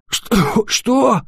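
хрипло, изумлённо, высоко; прохрипеть в изумлении; севший голос. Acted. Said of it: "изумлённо прохрипел Ник севшим голосом". (изумлённо, севшим голосом)

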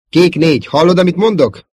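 loud, high, insistent questioning; urgent call. Acted. Wingman calling a damaged pilot to respond, urgent. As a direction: demand an answer from your wingman.

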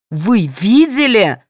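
mild, drawn-out indignant yell. A narrator's reading. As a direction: challenge them slowly, pitch lifted. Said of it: "заорала Галина".

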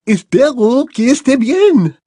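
a lofty headmistress, unhurried; Madame Maxime voiced.

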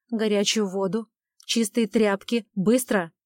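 loud, sharp emergency commands; urgent orders. Acted. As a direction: bark orders in a crisis. Urgent orders at bleeding woman.

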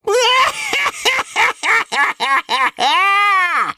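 long, loud, high villain's laugh; monstrous horror-film cackle; sinister cackle. Acted. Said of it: "Зловещим хохотом захохотала Гордения Евгения".

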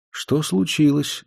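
softly fearful, a little slow; timid quiet question. A narrator's reading. Girl asking the silent room what happened, timidly. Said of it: "спросила она тихо и как-то боязливо".

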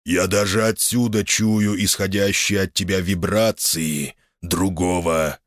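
slow, powerful voice from deep in a cave; commanding ancient voice. A narrator's reading. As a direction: command slowly, like an ancient dragon. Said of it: "полный власти голос из глубин пещеры".

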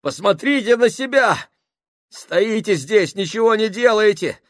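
high, loud breathless shout; gasping. Acted. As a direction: shout between gasps for air. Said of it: "задыхаясь".

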